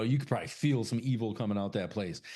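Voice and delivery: usual speaking voice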